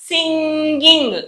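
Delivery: సింగింగ్ very slow, nasal "ng" held; సింగింగ్ drawn out as sing-ging; NG sound. Shown as a demonstration. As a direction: stretch both "ng" sounds in సింగింగ్. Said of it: "జ్ఞాగా పలుకుతాం అన్నమాట".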